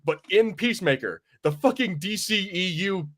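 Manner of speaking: usual speaking voice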